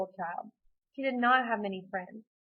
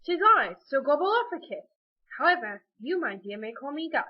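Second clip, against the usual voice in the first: loud, higher-pitched London turkey gentleman; London accent; 5 semitones higher, 6 dB louder than usual. Acted. Turkey introducing himself to Amy, grand London accent. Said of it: "because I'm from London".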